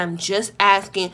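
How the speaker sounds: even, composed, no yelling; monotone. Retold or imitated. Narrator calmly defends the question to relative, monotone. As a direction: keep the pitch level and unbothered. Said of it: "I talked very monotone. I wasn't even yelling".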